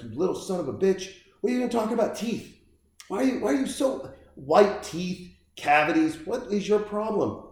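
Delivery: fast, high mock scolding dad; mock angry dad. Elf's father scolding his son about teeth, in a fast high mock rant. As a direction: rattle it off as a ranting father.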